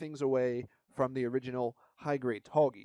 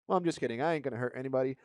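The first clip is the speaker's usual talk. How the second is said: usual speaking voice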